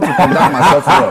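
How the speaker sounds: jokes with a laugh in his voice; chuckling through the words. Real, not acted. Joking about being in government.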